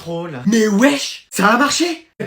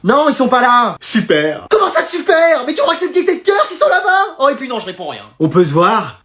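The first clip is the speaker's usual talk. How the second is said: ordinary talk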